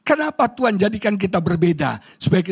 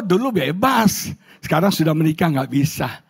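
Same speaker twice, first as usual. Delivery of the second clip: ordinary delivery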